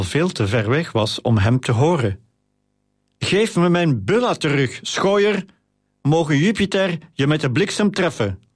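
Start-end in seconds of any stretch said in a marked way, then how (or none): 3.2-8.4 high-pitched indignant outburst, contained volume; storyteller's angry cry, pitched up; angry calling. A narrator's reading. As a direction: hurl a curse after a thief. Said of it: "terwijl hij nog steeds naar de dief riep".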